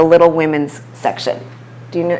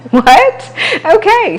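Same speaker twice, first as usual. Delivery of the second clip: laughing surprise; 13 semitones higher, 4 dB louder, slower than usual. Real, not acted. Reacting to competition news.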